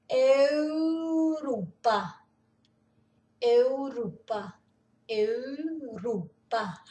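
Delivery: "Europa" said slowly as "Eurupa", with the eu rounded and o as u; Norwegian Europa. Shown as a demonstration. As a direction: draw out "Europa" syllable by syllable. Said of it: "complicadinho pra gente falar isso".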